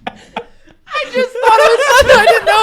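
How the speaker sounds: very high, loud, squealing with laughter; real laughing fit while explaining yourself; helpless laughter. Real, not acted. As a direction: barely get the words out for laughing. Storyteller cracks up mid-story.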